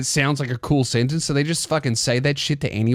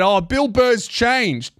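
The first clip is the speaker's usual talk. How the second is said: mock whine; 7 semitones higher, much slower than usual. Mocking conservative fans.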